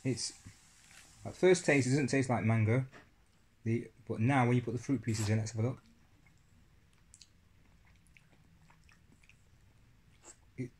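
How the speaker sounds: between spoonfuls of yogurt; talking while eating. Real, not acted. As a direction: mouth partly full. Seen 0: spoon at mouth, cup raised, tasting yogurt throughout the speech.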